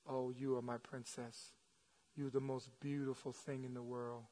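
very soft, low doting murmur; tender father. Acted. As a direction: speak softly to your little girl on your lap. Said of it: "her father tells us".

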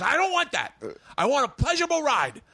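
high-pitched whiny demand; imitating a huffy friend; mock indignation. Imitating Bobby's angry complaint.

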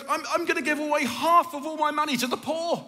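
a changed man's eager, high-pitched vow; excited. Retold or imitated. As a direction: blurt out the promise in delight. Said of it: "he goes".

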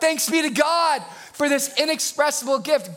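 excited preacher declaring the verse; exclaimed thanks. Real, not acted. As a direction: proclaim it with an exclamation point. Verse exclaimed after exclamation point point.

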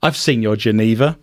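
normal talking voice

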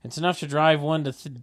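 ordinary delivery

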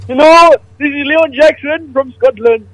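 silly fake Scottish. Prank caller posing as [name], silly fake Scottish accent. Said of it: "even stupider voice this evening".

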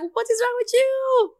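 high, slow, baffled question; incredulous partner. Pretend. Boyfriend stunned by her crying, high slow disbelief. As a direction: play the stunned boyfriend asking what's wrong. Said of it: "he's gonna be like".

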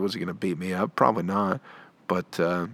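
ordinary talk, nothing marked

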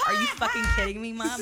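high, loud disbelieving cry; mock outraged yell. Daughter retelling yelling at her mom, high mock outrage.

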